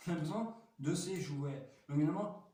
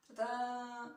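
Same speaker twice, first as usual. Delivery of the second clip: big reveal; 9 semitones higher than usual. Acted. Toy reveal.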